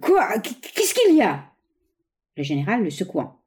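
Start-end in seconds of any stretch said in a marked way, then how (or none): 0.0-1.5 stammering, high-pitched astonishment; flabbergasted. A narrator's reading. Bois d'Anguin to the General who grabbed him, flabbergasted. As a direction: stumble on the first words, voice jumping up. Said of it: "Bois d'Anguin suffoqué".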